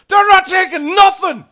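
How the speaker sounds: high, very loud outraged shout; angry druid Karnak. Acted. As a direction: bellow indignantly at the intruders. Game master voices angry Karnak.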